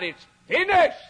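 high loud cry of "It's finished". A narrator's reading. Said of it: "and he cried".

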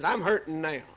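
ordinary talk

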